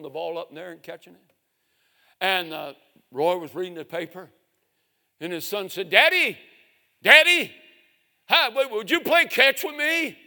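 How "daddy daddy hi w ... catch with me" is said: high, loud calling of Daddy; child calling out. Retold or imitated. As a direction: call up to Dad eagerly. Boy calls to reading father.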